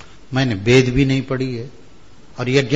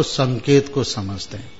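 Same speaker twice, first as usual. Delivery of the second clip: usual speaking voice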